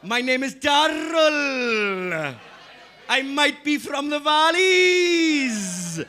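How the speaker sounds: high, loud singsong Welsh-Indian-Geordie accent; exaggerated drawn-out Welsh-Indian accent; Geordie-Welsh-Indian accent demo. Shown as a demonstration. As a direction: stretch the key words in an interchangeable Welsh, Indian or Geordie lilt. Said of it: "are perfectly interchangeable".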